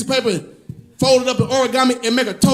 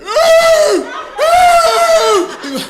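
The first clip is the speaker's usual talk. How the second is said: high loud dog roo-ing; dog howl imitation; 4 dB louder than usual. Comedian imitating his dog Bush howling, high and loud. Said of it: "He be like".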